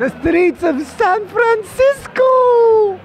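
high, loud, drawn-out actor impression; Michael Douglas impression. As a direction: belt it out slowly in a high impersonated voice. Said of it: "Do you do any other impressions?".

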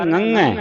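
ngੰਗ with a nasal ng sound at start and end; nasal pronunciation. Shown as a demonstration. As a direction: say ngੰਗ through the nose. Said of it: "ਇਸ ਦਾ ਉਚਾਰਨ".

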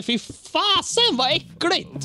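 high, slow, squealed disgust. Real, not acted. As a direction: recoil at the thought, voice jumping way up. Disgust at watered julmust.

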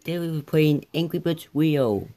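ordinary delivery

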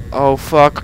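ordinary talk, nothing marked

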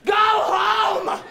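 high, sudden shout; snapping yell. Acted. Skater to a kid on a Razor scooter, yelling. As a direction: yell it across the park. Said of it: "I just yell something like".

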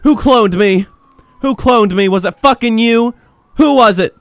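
high, loud paranoid cop; frantic shouting. Pretend. Cat officer surrounded by clones calling for backup, frantic shouting. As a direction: shout accusations and orders in play-acted panic.

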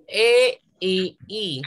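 "E y I" very slow, each vowel on its own; drawn-out teacher naming of "E y I"; isolated vowels. Shown as a demonstration. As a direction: spell out "E y I" with gaps between the sounds. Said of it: "la mayor fuerza de voz recae".

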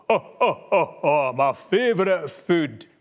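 a big hungry beast gloating; gruffalo voice. A narrator's reading. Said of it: "the gruffalo speaks, Scots tale".

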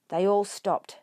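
ordinary delivery

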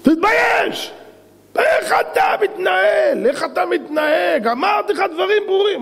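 furious high-pitched shout, drawn out; high, stretched-out angry scolding; yelling at him. Acted. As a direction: bawl out a subordinate at the top of your voice. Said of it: "צועק עליו".

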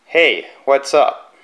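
slow, careful words for the phone to catch; slow dictation. Real, not acted. Reviewer dictating a text to his voice app, slow and deliberate. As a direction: spell it out slowly so the app hears it.

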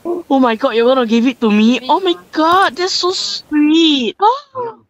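high squealing delight, building to a shriek; shrieking jackpot winner. Real, not acted. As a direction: squeal like you just won big. Teammate gives her the item.

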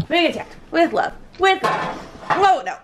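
cooing up high at the pet; sing-song pet voice, way up; high pet-talk coo. Real, not acted.

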